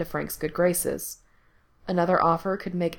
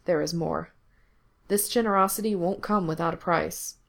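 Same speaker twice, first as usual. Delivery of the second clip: plain reading voice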